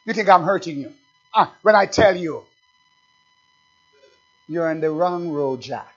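usual speaking voice

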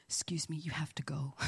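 hushed, breathy temple whisper; whispered priest. Retold or imitated. Temple priest shooing a praying visitor away, whispering. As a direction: quietly shoo the visitor out in a whisper. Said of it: "will come up and say".

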